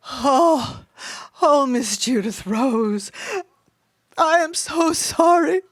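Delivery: tipsy blubbering. A narrator's reading. Mama apologizes to Miss Judith Rose, blubbering with gin. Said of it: "blubbering with her gin".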